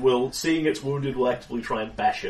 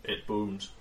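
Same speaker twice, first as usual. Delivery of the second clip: ordinary talk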